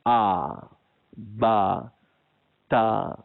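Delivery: "A, ba, ta" with the mouth wide open; open-mouth fathah. Shown as a demonstration. Teacher drilling the fathah vowel for students. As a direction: sound each "a" with the jaw dropped. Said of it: "baris fathah kena buka mulut".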